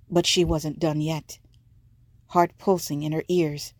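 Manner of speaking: usual speaking voice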